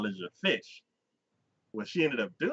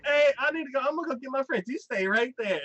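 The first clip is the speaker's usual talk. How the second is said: high-pitched bossy grandma, retold; mock urgent voice, pitched way up; excited grandmother; 13 semitones higher, 3 dB louder than usual. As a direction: imitate a grandma eagerly giving orders. Acted grandmother hurrying to fetch friends.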